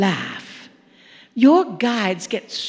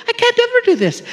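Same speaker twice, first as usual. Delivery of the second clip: much higher, laughing squeal of mock panic; 11 semitones higher, faster than usual. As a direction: squeak it out through a laugh, like a hopeless new driver. Driver's panic on first lesson.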